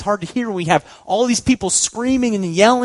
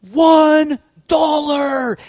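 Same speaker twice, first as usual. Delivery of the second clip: long, high, drawn-out shout; a slow high yelled one dollar from the audience; yelling a bid; 10 semitones higher, 5 dB louder, much slower than usual. Retold or imitated. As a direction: bellow the bid across the studio. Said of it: "the first one to yell out".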